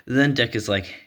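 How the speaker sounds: ordinary talk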